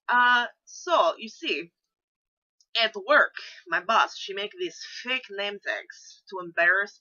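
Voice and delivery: hammed-up Russian storyteller; comic Russian accent with dropped articles; really bad Russian accent. Shown as a demonstration. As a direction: tell it in a thick, badly faked Russian accent. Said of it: "in my really bad Russian accent".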